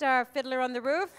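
ordinary talk

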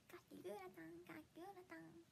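very soft, almost whispered; hushed repeat. Pretend. Streamer echoing the dish name to herself, hushed.

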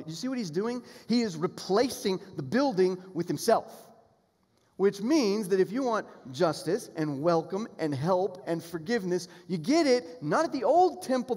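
high, loud preaching, the words pounded out; preaching at full force. Real, not acted. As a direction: drive it home to the congregation. Preaching climax.

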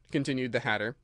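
ordinary delivery, nothing marked

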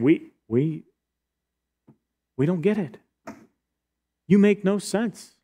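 stumble, then push it up in frustration; exasperated protest. Acted. Religious leaders protest to Jesus.

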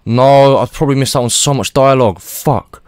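dismayed cry. Real, not acted. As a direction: blurt out the realization. Realizes missed dialogue.

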